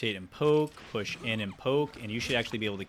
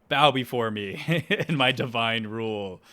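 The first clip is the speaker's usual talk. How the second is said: gloating; 7 dB louder than usual. Pretend. Gloating as tyrant king.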